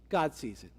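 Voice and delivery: low, noncommittal pronouncement after a long pause; mock-cryptic. Pastor answering an office visitor, mock-cryptic. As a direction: hold back, then drop it low as the whole answer.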